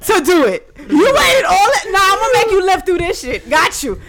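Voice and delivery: mock outraged. Acting out his reaction to victim.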